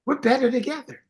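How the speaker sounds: uplifting slogan, pitched well up. Shown as a demonstration. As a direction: sing out "better together" high and bright. Repeats the line with emphasis.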